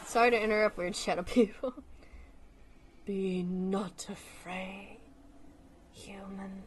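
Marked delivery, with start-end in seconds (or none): 3.1-6.6 very slow, soft, low angel proclamation; hushed, drawn-out angelic greeting; slow, dramatic angel. Acted. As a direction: draw it out solemnly and quietly like an otherworldly voice. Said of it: "that was way too slow and dramatic".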